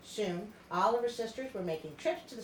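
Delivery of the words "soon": ordinary delivery, nothing marked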